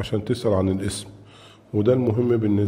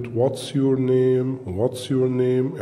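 what's your name drawn out slowly with stress on your; slow model question; 2 semitones higher, much slower than usual. Shown as a demonstration. Teacher repeats the question to show your, slowly. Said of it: "زي كلمة".

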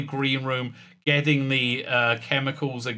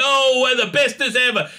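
mock inspirational speech; 9 semitones higher, 4 dB louder than usual. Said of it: "trying to channel the Tom Baker Ark in Space".

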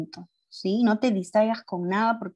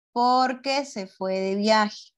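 slow, drawn-out answer; slow dictation; much slower than usual. Shown as a demonstration. Teacher writes out the answer for pupils, slow dictation. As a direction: spell out the answer at writing speed.

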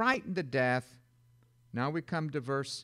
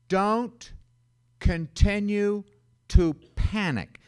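loud command; 5 semitones higher, 7 dB louder, slower than usual. Shown as a demonstration. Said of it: "perhaps translate this myself".

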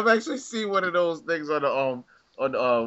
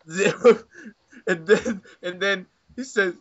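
usual speaking voice

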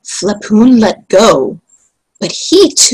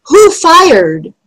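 high, mildly shouted question; shouted demand; 9 semitones higher, 4 dB louder than usual. A narrator's reading. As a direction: call out sharply, pitched high. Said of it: "shouted several voices".

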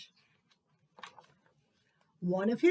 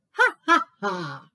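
mocking laugh; 3 semitones lower, 7 dB louder than usual. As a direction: laugh at the mouse.

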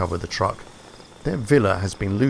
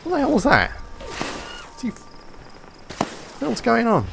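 startled; 12 semitones higher than usual. Real, not acted. Sudden attack while fleeing.

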